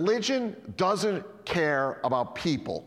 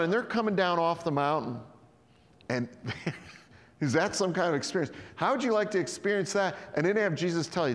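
usual speaking voice

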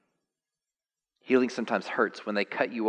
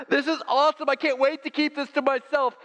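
very high, louder mock woman's voice; woman at the well; 6 dB louder than usual. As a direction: ham up the excited woman, high and loud. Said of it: "she was like".

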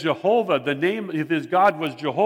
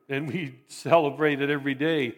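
usual speaking voice

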